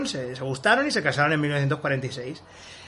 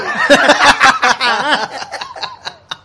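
loud high laugh; 9 semitones higher, 8 dB louder than usual. Real, not acted. Joke about Lieutenant Mambrú.

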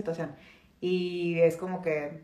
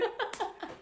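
breathless laugh; 12 semitones higher, 12 dB quieter than usual. Real, not acted. Joke continues.

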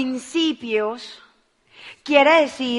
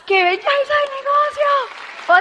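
high, slow, thrilled cry; excited exclamation; 13 semitones higher, 4 dB louder, slower than usual. Real, not acted. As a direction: marvel out loud at the business. Exclaims about the business.

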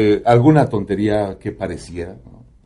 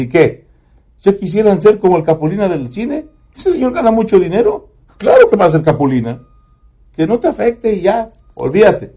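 higher, brisk motherly reassurance; mother's voice; 9 semitones higher than usual. Retold or imitated. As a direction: play his mom shrugging off the teasing. Mother consoling bullied son.

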